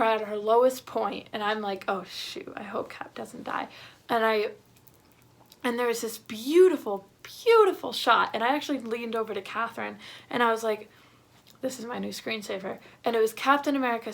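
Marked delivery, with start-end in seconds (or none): 1.9-3.7 soft, hushed, anxious whisper; worried whisper. Retold or imitated. Her fearful thought in the theater. 11.6-12.8 softer theater murmur to a friend; hushed aside. Retold or imitated. Leaning over to Catherine mid-movie.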